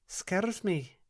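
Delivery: high, lilting Scottish brogue; a gentle Scots spirit coaxing the girl; Scottish dream spirit. Acted. Alpina's Scottish voice.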